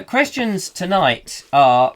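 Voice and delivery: ordinary talk, nothing marked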